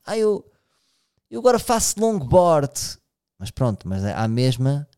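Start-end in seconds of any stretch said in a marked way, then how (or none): 0.1-3.0 high, slow, show-off drawl; lightly mock someone bragging about their new hobby; mock poser. Mocking people who pretend to change, a high slow poser voice. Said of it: "há pessoas que fingem que se viraram do avesso".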